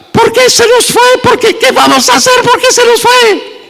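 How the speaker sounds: very high-pitched, hammed-up wail; mock wailing, sustained way up high; wailing funeral mourner. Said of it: "ella estaba llorando y dice".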